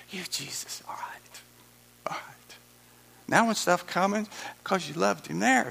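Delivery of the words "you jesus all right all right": hushed, intimate whisper. Acted. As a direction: breathe it softly, like a secret. Whispered dialogue with God.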